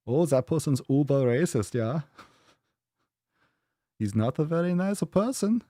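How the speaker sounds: put-on campy German accent, pitched well up. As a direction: play a flamboyant German sneering at someone, with a laugh. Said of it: "You gotta default to that German accent".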